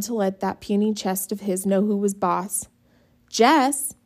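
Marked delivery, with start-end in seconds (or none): 3.3-3.9 calling Jess, higher and louder; yelling. A narrator's reading. Little sister calling Jess in loudly. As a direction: shout his name across the yard. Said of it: "Maybelle yelling".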